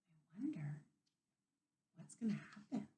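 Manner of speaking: put-on whispered, curious aside to children; hushed wondering whisper; suspense whisper. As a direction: whisper as if sharing a secret.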